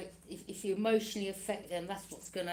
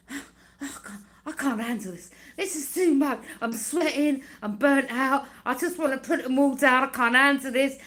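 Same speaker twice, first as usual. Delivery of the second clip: loud, higher hammed-up complaining; mock exhausted moan; 5 semitones higher, 10 dB louder than usual. Mimicking enemies exhausted carrying burdens uphill, hammed-up moan. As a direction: whine like someone worn out on a hill.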